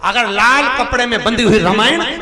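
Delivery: preacher in full flow; fiery preaching. Acted. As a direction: rouse the crowd with each line.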